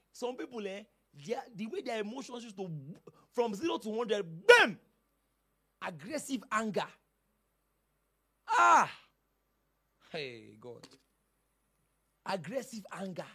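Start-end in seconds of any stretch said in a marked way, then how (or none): 4.5-4.8 sudden loud bam; a shouted bam of sudden rage; explosive bam. Retold or imitated. Sudden anger outburst illustrated. 8.5-8.9 loud high angry ah; a shouted ah of rage; angry yell. Retold or imitated. Acting out aggressive anger.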